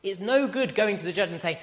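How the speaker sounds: ordinary talk, nothing marked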